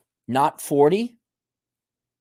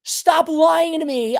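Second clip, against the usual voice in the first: high, slow, drawn-out exasperation; exasperated scolding; 12 semitones higher, slower than usual. Real, not acted. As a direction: scold them, stretching it out high. Scolding the viewer.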